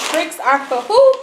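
drawn-out rising question; sing-song call-out. Retold or imitated. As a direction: call it out like the commercial. Calling out commercial slogan.

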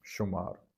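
ਸ਼ੁਮਾਰ with a clear sh sound, falling; correct reading. Shown as a demonstration. Teacher gives the correct sound of ਸ਼ੁਮਾਰ. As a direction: say ਸ਼ੁਮਾਰ with a crisp sh. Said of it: "ਸ਼ੁਮਾਰ ਪੜ੍ਹਨੇ ਨੂੰ".

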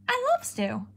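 high, gushing adoration of stew; cat's delight. A narrator's reading. Cat dreaming of stew, high gushing delight. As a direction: swoon over the word stew.

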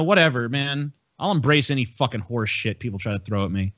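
usual speaking voice

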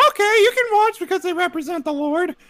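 very high cartoon mom; mom in Mickey Mouse voice. Mom allowing Power Rangers, high Mickey Mouse voice. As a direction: give in as a squeaky cartoon mother. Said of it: "doing my mom's voice and a Mickey Mouse voice".

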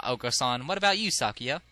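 ordinary talk, nothing marked

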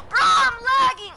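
high, loud gamer yell; frustrated yell. Real, not acted.

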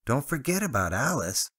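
high and bright, beaming. Acted. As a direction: say it with a big grin. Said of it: "Travis beamed".

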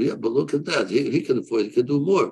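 usual speaking voice

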